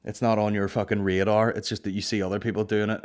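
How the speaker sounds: ordinary talk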